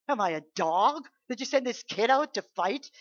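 loud, scornful giant's sneer; loud derisive taunt; mocking giant. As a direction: shout down at the boy with contempt. Goliath mocking the Israelites.

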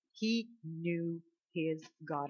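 soft and very slow, word by word; slow, weighty repetition. Real, not acted. Preacher repeating David's secret to the congregation, slow and solemn. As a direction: let each word of the quiet line land.

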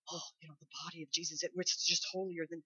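gasp, then hushed quick whisper; mock pious awe. Preacher mimicking religious thinking about communion, hushed awe. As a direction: whisper it in exaggerated holy reverence. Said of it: "in our religious thinking is like".

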